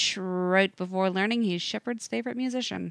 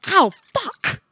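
pained yelp; 12 semitones higher, 8 dB louder than usual. Acted. Hand shocked by artifact.

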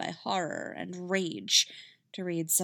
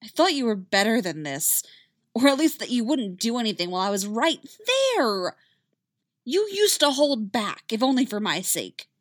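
louder hurt reproach; hurt and angry; upset; 4 semitones higher, 5 dB louder than usual. Acted. Ahsoka upset argument.

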